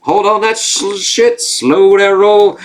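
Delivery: high, loud, slowed-down mock Leslie voice; imitating Leslie calming things. Podcaster imitating a character calming the monster, high and slow. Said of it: "Leslie's like".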